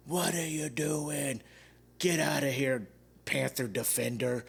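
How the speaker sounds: a lightly voiced drunk heckler, slow; mock the heckler dragging the words out; imitating a drunk yell. Drunk fan heckling the Panthers defense, lightly mocked yell. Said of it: "he had to yell at everything".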